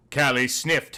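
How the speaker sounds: usual speaking voice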